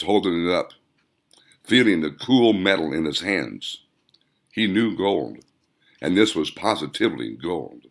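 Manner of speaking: plain reading voice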